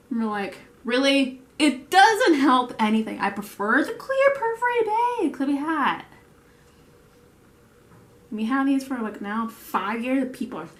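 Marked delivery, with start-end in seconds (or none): 0.8-6.0 higher, louder acted protest; mock incredulous. Staff answering the bag specialist, mock incredulous. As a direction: build to a high, indignant mock complaint. Staff retort about costly new bags.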